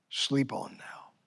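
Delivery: soft slow whisper; hushed whisper to sleepers. Acted. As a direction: breathe it out gently. Jesus to sleeping disciples.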